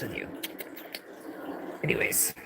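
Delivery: breathy whispered aside. Real, not acted. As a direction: drop to a whisper. Muttered aside.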